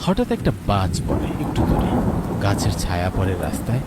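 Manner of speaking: calm, measured storyteller voice; wistful story narration over music. A narrator's reading. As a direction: set the scene like a radio drama narrator. Narrator describes lightning.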